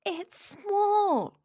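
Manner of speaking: thrilled high exclamation; excited cry. Acted. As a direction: shout out the find. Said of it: "cried Piglet".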